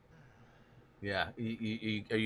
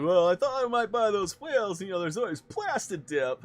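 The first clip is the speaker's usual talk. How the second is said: high, loud sarcastic suggestion; 9 semitones higher, 5 dB louder than usual. Retold or imitated. Teasing the wheel owner about plastic dip, sarcastic. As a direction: needle him with a mock-innocent idea. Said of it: "I was kind of being sarcastic".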